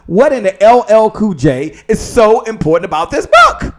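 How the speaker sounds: loud, excited question ending in a shout; hyped-up demand. Real, not acted. Host demanding why her book matters, excited and loud. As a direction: build to a high shout on BOOK.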